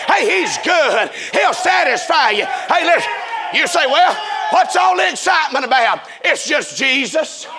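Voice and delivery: full-force, driving revival sermon; impassioned preaching. Real, not acted. Preacher urging congregation that nobody is like Jesus, impassioned. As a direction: preach it hard, stretching out the big lines.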